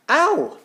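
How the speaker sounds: sharp high yelp of pain, loud; sudden cry of pain. Acted. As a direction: cry out as the rocks hit you. Girl hurt by falling rocks.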